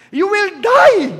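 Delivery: slow, loud, each word weighed; slow and heavy verdict; solemn warning. Real, not acted. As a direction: hammer home the doom. Preacher pronouncing doom slowly.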